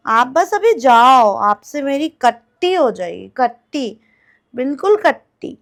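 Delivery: loud, sulky telling-off of a pet; mock scolding. Mummy telling the cat off for yelling at her, mock scolding. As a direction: scold like a playfully offended mother.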